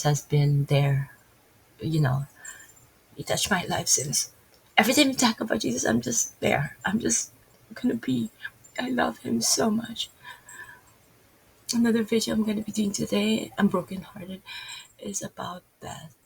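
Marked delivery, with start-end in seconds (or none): 4.8-16.1 high, choked-up devotion; tearful. Real, not acted. Speaker overcome talking about Jesus, tearful. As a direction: speak through real tears, pitched up. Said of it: "I'm not crying just because".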